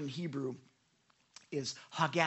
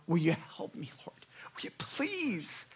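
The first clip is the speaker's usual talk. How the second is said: desperate sighing plea. Acted. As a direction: groan a whispered plea to God. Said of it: "like, sighing".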